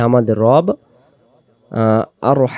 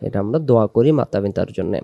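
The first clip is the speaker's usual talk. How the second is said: ordinary talk, nothing marked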